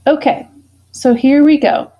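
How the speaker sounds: high, loud "Okay, so here we go"; rally the class back in; upbeat restart. Real, not acted. Teacher restarting the lesson.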